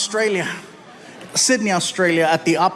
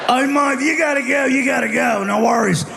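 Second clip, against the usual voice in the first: put-on higher, slurred drunk Aussie voice; drunk Aussie pirate; 6 semitones higher than usual. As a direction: slur it like a friendly drunk pirate. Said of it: "drunk pirate underwater".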